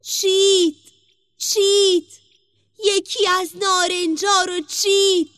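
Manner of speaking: high, loud tattling tree voice; magic tree. A narrator's reading. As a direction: call out the theft in a high character voice. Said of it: "درخت جادو به صدا در اومد". (صدای بلند و زیرِ درخت جادو)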